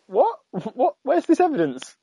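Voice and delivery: high, disbelieving exclamation; baffled, searching in disbelief; incredulous what. Retold or imitated. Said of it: "you're like".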